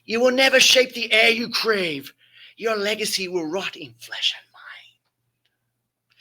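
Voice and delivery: high witch voice fading to a slow whisper; witch's curse. Acted. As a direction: hiss the curse like an ancient witch. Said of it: "the witches placed a curse".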